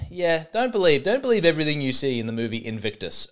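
usual speaking voice

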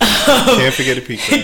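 laughing through the line; giggling while saying it. Real, not acted. Host laughing echo.

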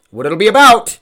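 sudden high, loud squeaky voice on the last words; mock squeak. Goofy squeak. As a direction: jokes about losing his train of thought, squeaks out the phrase. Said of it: "Already losing it".

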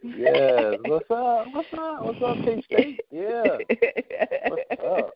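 high, hyped-up shout-out; excited greeting. Real, not acted. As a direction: greet the crowd with energy. Guest greets a college audience.

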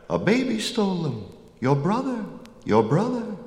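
very high-pitched crow chatter. A narrator's reading. Crows tell Apollo who stole the cows, high chattering voices. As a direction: repeat it like tattling crows, pitched far up. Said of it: "The crows chattered".

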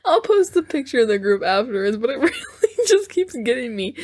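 giggling through the words, real laughter; can't stop laughing while apologizing. Real, not acted.

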